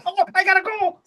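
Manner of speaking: very high, flustered gnome voice; hurried gnome. Acted. Gnome leaves to pull lever, high hurried voice. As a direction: squeak a hasty exit. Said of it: "And he goes".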